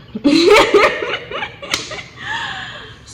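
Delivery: loud high-pitched laugh breaking out; high burst of laughter. Real, not acted. Laughing at her own story, high burst of laughter.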